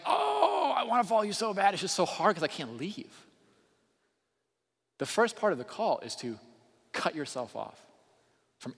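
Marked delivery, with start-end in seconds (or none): 0.0-3.3 mock anguished pleading. Preacher acting Abraham as if unable to leave, mock anguished pleading. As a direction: ham up the agonised reluctance, starting high. Acted reluctant follower pleading with God.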